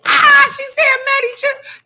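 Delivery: delighted outburst; excited shout. Real, not acted. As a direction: yell it out as it clicks. Realizing the money was for chips.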